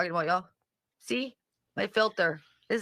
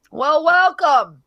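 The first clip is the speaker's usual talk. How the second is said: loud drawn-out welcome; 6 semitones higher, 10 dB louder, slower than usual. Real, not acted. Greeting newcomer to live.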